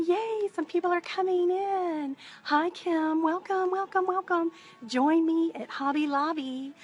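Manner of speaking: real cheery hostess welcome; excited welcome. Real, not acted. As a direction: greet newcomers with delighted energy. Greeting viewers as they join live.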